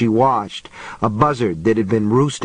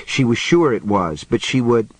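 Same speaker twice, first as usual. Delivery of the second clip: plain reading voice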